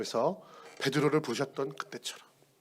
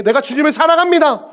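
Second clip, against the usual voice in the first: confident declaration; 11 semitones higher, 14 dB louder than usual. Acted. Peter's confident answer voiced.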